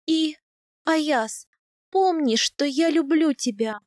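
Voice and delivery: high, strained declaration; strained, emotional plea. A narrator's reading. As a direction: say it through pain, as a farewell. Wife in agony pleads to husband.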